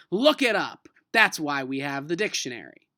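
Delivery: a teacher's proud call to arms; battle cry. Acted. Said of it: "Missus Granger battle cry".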